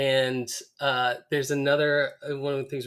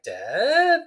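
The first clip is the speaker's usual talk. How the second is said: surprised; 10 semitones higher, 8 dB louder than usual. Retold or imitated. Kids spot Homer's face on album.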